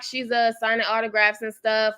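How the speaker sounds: usual speaking voice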